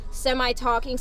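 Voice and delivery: ordinary delivery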